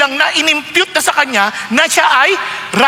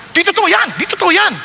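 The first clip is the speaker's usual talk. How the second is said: mock angry denial; 6 semitones higher than usual. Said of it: "gagalit tayo".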